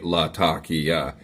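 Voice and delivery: Latakia drawn out slowly, lah-TAH-kee-yah; correct pronunciation, slow. Retold or imitated. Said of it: "he said, say it right".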